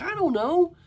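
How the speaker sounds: imitated "no idea" squeal; mock clueless protest. Retold boyfriend answering an accusation.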